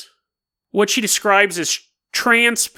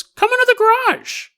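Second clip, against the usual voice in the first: much higher, otherworldly dog voice; the dog beckoning her inside; a guard dog speaking, pitched way up; talking dog. Retold or imitated. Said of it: "The dog looks at her and goes".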